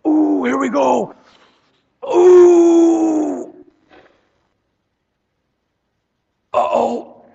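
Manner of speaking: hammed-up grunt then a worried uh-oh; straining under weight. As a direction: act out lifting too much and failing.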